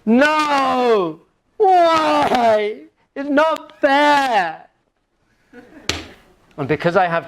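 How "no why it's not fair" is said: high, drawn-out mock whine; hammed-up childish whine, very high; exaggerated whining. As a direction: wail it like a protesting child. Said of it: "Come on, let's whine".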